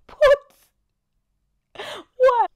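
high, loud, stunned What? twice; baffled disbelief. Retold or imitated. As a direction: react as if the offer makes no sense. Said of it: "I'm like".

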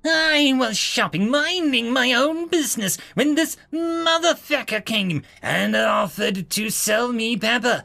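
high, sharp nagging voice of an entitled customer; shrill impatient woman. Acted. Entitled human complaining to the officer, shrill and high. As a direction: snap at her like a spoiled shopper.

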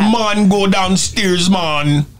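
slow Jamaican accent, heavy emphasis on "man"; exaggerated Jamaican accent. Shown as a demonstration. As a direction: lean hard on each "man" in Jamaican. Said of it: "put a lot of emphasis on Martin".

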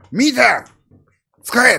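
a mock yell at the cab driver, drawn out; forceful retold shout. Retelling how he told the Vietnamese taxi driver to use the meter, a mock yell. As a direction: bark the order the way he did at the taxi. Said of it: "強気に言えって言われて".

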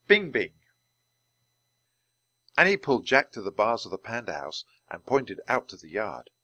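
plain reading voice

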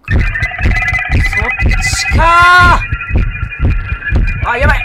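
loud panicked yell as it appears; yelling in alarm at the monster; startled shout. Real, not acted. Player spotting the monster, panicked yell.

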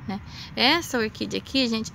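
ordinary talk

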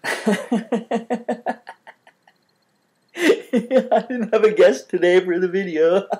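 laughing at yourself. Shown as a demonstration. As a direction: chuckle at your own lonely setup. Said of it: "how about laughing at yourself".

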